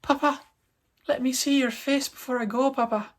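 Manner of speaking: put-on high little Irish-accented child voice; squeaky, pleading mock Irish accent. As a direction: beg as a tiny creature with an Irish accent. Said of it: "threw in an Irish accent".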